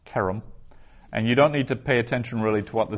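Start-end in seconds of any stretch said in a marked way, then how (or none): 0.1-0.4 Kerem with KE stressed, REM light; stress on first syllable. Shown as a demonstration. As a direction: lean on the KE of Kerem. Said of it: "that's accenting the ke".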